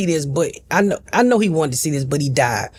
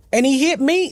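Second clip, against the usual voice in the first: ordinary delivery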